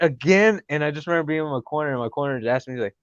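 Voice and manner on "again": mock outrage. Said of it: "I was like".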